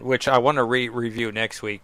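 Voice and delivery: ordinary talk, nothing marked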